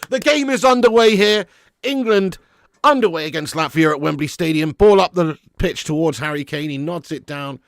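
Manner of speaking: lively live match commentary; play-by-play commentary. Acted.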